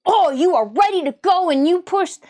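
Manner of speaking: a mocking hyped-up voice; mock hype. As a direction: hype them up sarcastically. Mocking troll's buildup.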